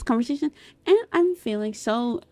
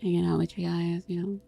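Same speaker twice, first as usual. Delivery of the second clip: worn-out, hushed chatting; soft, tired talk; 2 semitones lower, 8 dB quieter, faster than usual. Shown as a demonstration. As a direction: talk really softly, tired and calm. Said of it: "I was talking really softly".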